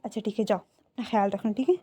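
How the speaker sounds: gentle. Acted. Lainey lets son go out.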